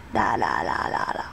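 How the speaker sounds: low nasal la la, half whispered; nasally la la. Shown as a demonstration. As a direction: sing la la through a blocked nose. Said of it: "trying to talk with a nasally voice".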